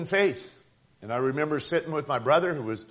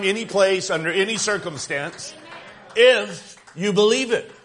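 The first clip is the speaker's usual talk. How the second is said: louder preaching, slowing on the last words; full-force preaching; 3 semitones higher, 4 dB louder than usual. Acted. Preacher drives home God's word to the church, with full-force preaching. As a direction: preach it out to the congregation.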